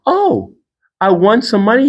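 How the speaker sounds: surprised. Retold or imitated. Retelling winning the lottery.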